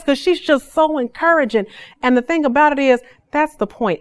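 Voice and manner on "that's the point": ordinary talk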